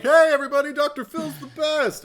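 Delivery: high, loud hammed-up cheer; mock enthusiasm. Joking to co-host about Doctor Phil shout-outs, mock enthusiasm. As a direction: shout it like an over-eager plug, drawing out the end. Mock announcing a celebrity endorsement.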